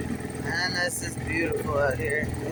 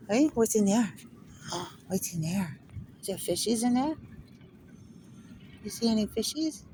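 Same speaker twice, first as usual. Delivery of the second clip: higher sing-song pet voice; 4 semitones higher, 4 dB quieter than usual. Real, not acted. Baby talk to dog at water.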